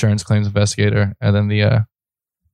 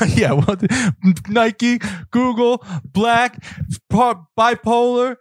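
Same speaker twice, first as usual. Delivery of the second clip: [name] impression; 13 semitones higher than usual. Imitating [name] rant.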